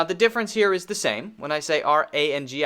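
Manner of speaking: ordinary talk, nothing marked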